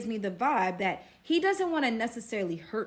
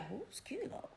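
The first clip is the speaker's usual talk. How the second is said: hushed, higher-pitched bewilderment; puzzled aside; 6 semitones higher, 14 dB quieter than usual. Retold or imitated. Storyteller voicing her own confused reaction, hushed puzzled question. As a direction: drop to a tiny, baffled near-whisper.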